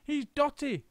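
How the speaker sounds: high teasing chorus; cheeky kids calling names; high teasing monkeys; mocking monkeys. A narrator's reading. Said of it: "they cried".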